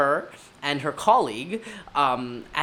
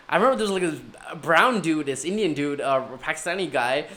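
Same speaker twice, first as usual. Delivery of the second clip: usual speaking voice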